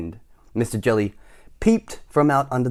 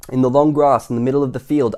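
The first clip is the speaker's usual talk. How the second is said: ordinary delivery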